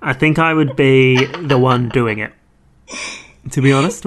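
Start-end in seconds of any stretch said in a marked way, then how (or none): none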